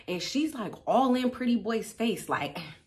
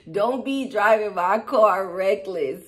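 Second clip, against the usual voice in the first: usual speaking voice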